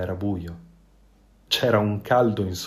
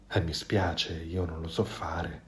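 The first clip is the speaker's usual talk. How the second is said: much softer, low, sheepish reply; embarrassed fox; 5 semitones lower, 11 dB quieter than usual. A narrator's reading. The fox confesses to the goose he cannot swim, soft and sheepish. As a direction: admit it shyly, almost under your breath. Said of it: "arrossì Volpe".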